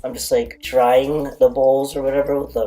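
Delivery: ordinary talk, nothing marked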